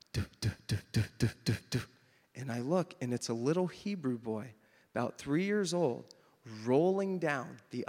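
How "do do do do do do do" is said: low, evenly repeated thudding noises; imitated tumbling noise. Imitating a little boy rolling down the plane aisle, thudding. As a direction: mimic something tumbling down the aisle. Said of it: "I hear down the aisle".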